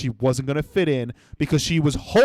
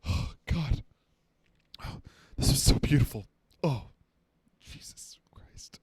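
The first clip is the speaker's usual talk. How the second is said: breathy, softer awestruck voice drifting to a whisper; mock blissed-out guy; 3 semitones lower, 8 dB quieter than usual. Mocking the meditating guy's awed murmur, softer and softer. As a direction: play a man overcome by beauty, fading to a whisper. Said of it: "he goes".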